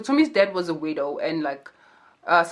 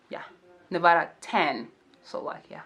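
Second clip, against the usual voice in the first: ordinary delivery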